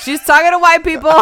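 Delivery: high, loud comic outcry; hammed-up horrified announcement; mock alarm. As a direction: shout it like a scandalized sister. Sisters' alarm acted out.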